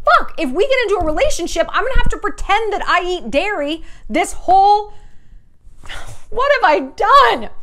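high, loud, fast rising panic, slowing at the end; inner panic. Retold or imitated. Panicking to herself about pretending to eat dairy, loud and high. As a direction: spiral into mock dread.

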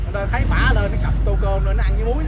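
loud complaining. Acted. Mother complains loudly about the kids.

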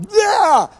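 high, loud mock roar; angry outburst. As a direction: burst out with a blast of temper. Retold outburst at wife.

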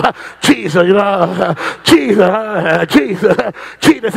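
slow, low calls on Jesus with gasps between; imitating altar seekers, drawn-out. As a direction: call the name slowly, catching breath after each. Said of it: "They call him faster".

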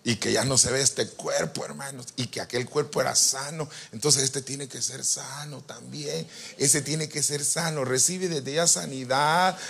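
a slow prayer voice declaring healing; soft healing prayer. Acted. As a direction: speak healing over them slowly and gently.

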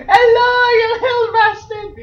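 very high, loud squealed greeting; hysterical squeal. Real, not acted. Player greeting the little creature in the game, hysterical squeal.

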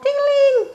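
very high ringing ding-a-ling; bell imitation. A narrator's reading. Narrator sounding Froggy's new bell, high ringing. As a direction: sing it out like a little bike bell.

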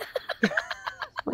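real airy burst of laughter; high silent giggle fit; high breathless laugh. Real, not acted. Laughing at the rebuke. (צחוק גבוה ונשוף)

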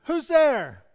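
loud puzzled call, high up. Acted. Lost boy calls toward the echo, loud. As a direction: shout it into the distance.

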